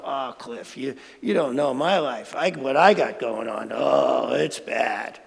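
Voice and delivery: a listener grumbling back to Cliff; doubtful listener. Pretend. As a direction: moan it like a gloomy skeptic. Imagined listener objecting to Cliff.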